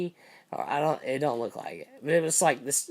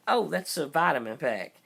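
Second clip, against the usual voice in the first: ordinary talk, nothing marked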